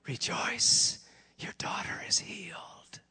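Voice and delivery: hushed, breath-only whisper; tender whisper. Acted. God's message of healing to the mother, whispered tenderly. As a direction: whisper the good news.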